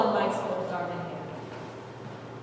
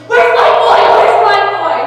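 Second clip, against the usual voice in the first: panicked mother, much higher and louder; frantic scream; 8 semitones higher, 16 dB louder than usual. A narrator's reading. Mother with baby running past, frantic scream. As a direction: scream searching desperately for her son. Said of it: "She screamed".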